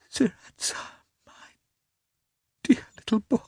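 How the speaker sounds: breathless mother, high and broken; catching her breath. A narrator's reading. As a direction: utter it tenderly, catching the breath. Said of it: "catching her breath".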